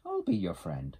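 high-pitched friendly offer; high storybook beaver; little beaver. A narrator's reading. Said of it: "said Little Beaver".

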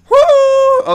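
very high, loud whoop. Real, not acted. Player psyching up to go, high whoop. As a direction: let out a piercing woo.

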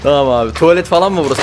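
usual speaking voice

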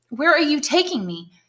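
high, urgent plea; desperate prisoner pleading with his guard. Acted. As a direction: beg him for an answer. Prisoner begs the gendarme.

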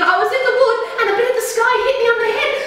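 very fast and high, laughing; excited chick. Acted. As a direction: tumble it all out excitedly. Chicken Licken excited in story.

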